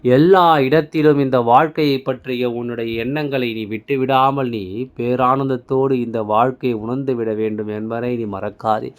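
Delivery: ordinary talk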